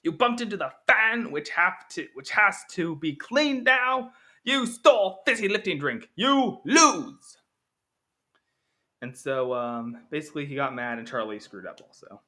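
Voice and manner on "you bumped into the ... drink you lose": high, loud furious scolding; angry Willy Wonka. Retold or imitated. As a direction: shout the rule-breaking at them. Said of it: "Willy Wonka just got really mad".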